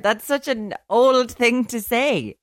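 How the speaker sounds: slow, high, fond coo. Real, not acted. Reacting fondly to child's remark.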